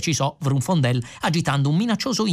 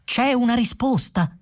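high, anxious question; eager high-voiced asking; bated breath; 10 semitones higher than usual. A narrator's reading. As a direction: ask it pitched up, hanging on the answer. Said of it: "chiese Fuchs col fiato sospeso".

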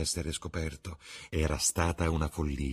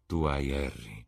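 sadly; 3 semitones lower than usual. Acted. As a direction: let it sound a little wounded. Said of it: "disse il pittore tristemente". (con una punta di tristezza)

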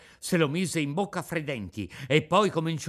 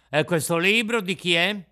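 louder demanding question; stern officer; 5 semitones higher, 8 dB louder than usual. A narrator's reading. The carabiniere demands whose book it is, loud. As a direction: bark out the question.

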